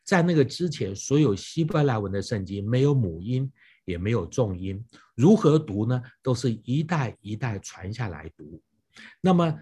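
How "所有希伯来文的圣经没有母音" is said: normal talking voice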